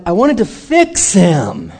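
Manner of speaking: FIX stretched out slowly with force; slow, drawn-out stress on FIX; emphatic confession. Real, not acted. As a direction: lean hard and long on FIX. Preacher stresses her confession.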